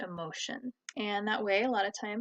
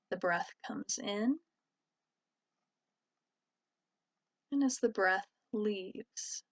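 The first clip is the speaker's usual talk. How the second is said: calm, even voice with long pauses; guided meditation. Real, not acted. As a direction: guide listeners gently inward, leaving space between phrases. Leads a meditation.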